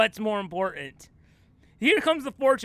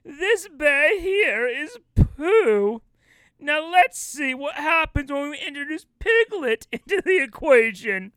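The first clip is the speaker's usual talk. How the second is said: high, loud, theatrical storybook voice; Christopher Robin; 6 semitones higher, 4 dB louder than usual. Acted. Actor as storybook boy presenting his bear, hammed-up. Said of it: "I am Christopher Robin".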